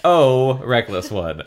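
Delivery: snide. Acted. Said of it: "Marco said snidely".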